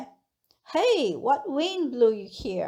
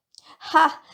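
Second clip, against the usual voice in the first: short loud "哈" pitched up; a touch of surprise in one raised burst; 4 semitones higher, 6 dB louder than usual. Shown as a demonstration. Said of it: "express pride or surprise".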